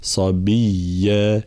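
صبيا said very slowly with the doubled ya held; slow drawn صبيا, ya doubled; doubled ya shown. Shown as a demonstration. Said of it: "لابد من بيان تشديدها".

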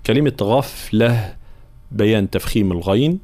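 ordinary delivery

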